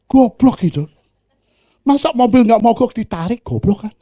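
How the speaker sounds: ordinary talk, nothing marked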